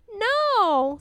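put-on high, childish no; a stubborn child's refusal; little princess. A narrator's reading. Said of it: "said the little princess".

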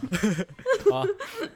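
laughing. Real, not acted. Teased about a cheesy phrase.

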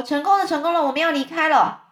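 high, loud announcement; triumphant shout. A narrator's reading. Centipede pokes head in announcing success, shouting. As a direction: shout the good news down to everyone. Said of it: "大叫说".

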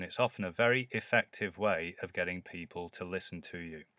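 ordinary talk, nothing marked

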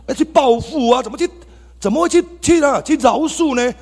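high, loud disbelieving protest; incredulous outcry. Retold or imitated. Recalling his gangster self, high loud incredulous outcry. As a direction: cry out as if forgiving is absurd. Outraged at pastor's advice to forgive.

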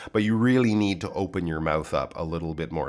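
usual speaking voice

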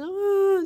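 short high sob sound, loud; imitated sob. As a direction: let out one loud sobbing hum. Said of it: "我还哭出声".

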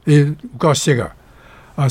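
ordinary talk, nothing marked

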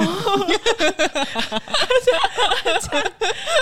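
long high rising laugh; real amused cackle. Real, not acted. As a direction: burst into a long giggle. Remembering the classmate's line.

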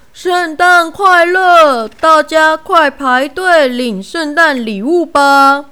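loud announcement. A narrator's reading. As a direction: announce it to the whole crowd. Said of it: "大声宣布说".

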